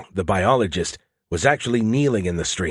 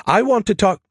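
put-on loud, very high childish demand; insistent protest; 11 semitones higher, 5 dB louder than usual. A narrator's reading. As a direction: protest loudly, pitched way up. Skippy protests being put off.

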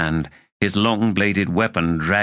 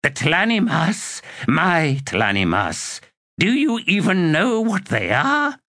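higher, indignant sorceress voice; possessive old witch; 10 semitones higher than usual. A narrator's reading. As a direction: claim them as your own, scornfully. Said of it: "Olar Ethil claims her undead".

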